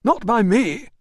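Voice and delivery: loud, high denial; insisting. Acted. Manager cuts in against his doorman, loud and high. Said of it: "insisted Priscus".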